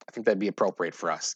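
ordinary talk, nothing marked

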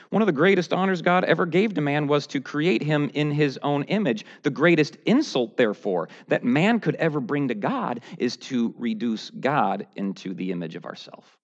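usual speaking voice

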